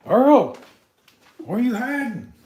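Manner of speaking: loud high call of the name. Real, not acted. Searching the dark house for his pet, loud call. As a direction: yell to find him in the dark.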